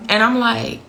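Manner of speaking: ordinary talk, nothing marked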